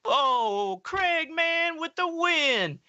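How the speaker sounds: high, slow mock cheer; mock celebration. Friend praising Craig for finding the lost rock, high mock cheer. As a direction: hail him as the hero, way up high. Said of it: "It's like".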